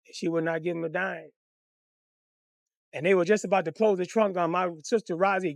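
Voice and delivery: usual speaking voice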